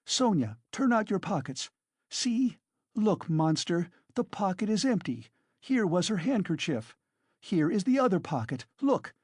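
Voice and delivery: triumphant fury. A narrator's reading. Katerina shows the empty pockets.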